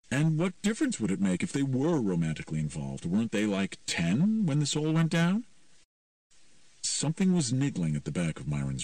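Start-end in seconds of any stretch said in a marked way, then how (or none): 0.1-5.5 put-on higher teen voice, questioning; hint of an incredulous teenager; lightly voiced teen. Teen challenges Myron's romance theory, lightly voiced teen.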